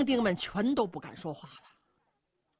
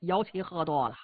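usual speaking voice

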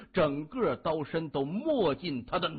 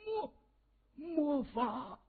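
high, stammered gasp of awe; stunned disbelief; 11 semitones higher, 3 dB quieter, slower than usual. A narrator's reading. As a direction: stutter it out in a high, softened voice. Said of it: "难以置信地看着谢文东".

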